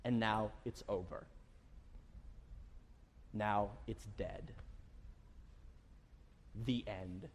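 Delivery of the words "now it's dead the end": softer, lower, slow and final; lower, hushed final verdict; grim and quiet. Acted. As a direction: let it drop, dead and done. Dramatic pause on failure.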